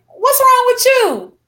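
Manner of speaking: retold shocked question, high and drawn out; alarmed disbelief. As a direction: recreate the panic at seeing her cry. Alarmed quote to crying nurse.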